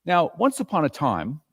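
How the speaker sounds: usual speaking voice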